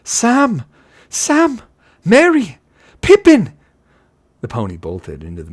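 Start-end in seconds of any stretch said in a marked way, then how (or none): 0.0-3.5 very high, loud frantic calls; full-voiced desperate yelling; wild shouting. A narrator's reading. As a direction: shout wildly for lost companions. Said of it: "shouting wildly".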